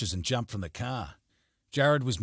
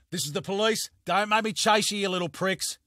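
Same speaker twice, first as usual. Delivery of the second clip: put-on higher, louder shout at fleeing kids; police yell; 11 semitones higher, 6 dB louder than usual. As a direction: yell after them with authority. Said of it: "he yelled".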